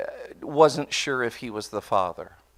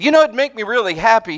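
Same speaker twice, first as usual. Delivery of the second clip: eager plea; 10 semitones higher, 9 dB louder, faster than usual. Retold or imitated. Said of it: "and Janae said".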